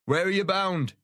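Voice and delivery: high called question across the water; a high shouted hail; shouting across. A narrator's reading. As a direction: hail the other ship's crew. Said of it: "he shouts".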